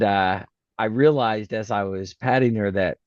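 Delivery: ordinary delivery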